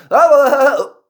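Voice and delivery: high, loud "ah-ah-ah"; imitated group outburst. Whole group reacting before dead silence, loud imitated outburst. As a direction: burst out loudly, then stop dead. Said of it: "And everybody goes".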